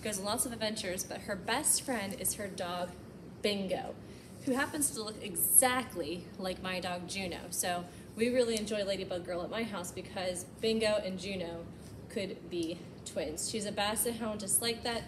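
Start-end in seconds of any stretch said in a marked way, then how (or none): none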